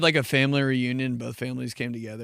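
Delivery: ordinary talk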